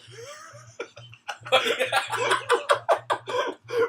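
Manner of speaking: high, loud burst of laughter; real howling laugh; shrieking laugh. Real, not acted. Host laughing at confession.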